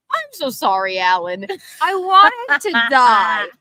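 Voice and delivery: loud, slow mortified voice; embarrassed apology. Retold or imitated. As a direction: wail the apology dramatically. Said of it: "She goes".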